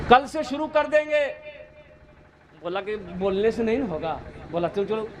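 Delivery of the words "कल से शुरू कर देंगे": eager agreement. Retold or imitated. Villager eagerly agrees to start praying.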